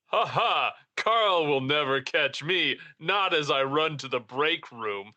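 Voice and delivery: slow, gloating imitation of a coworker; taunting call-back. Impostor calling back to bait Carl, slow taunt. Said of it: "Just kind of calls back down there".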